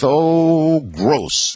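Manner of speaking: drawn-out, disgusted mock squeal; mild mock shriek. A narrator's reading. Kids watching the lizard birth, mild disgusted shriek. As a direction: imitate grossed-out kids, opening high and falling. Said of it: "they shrieked".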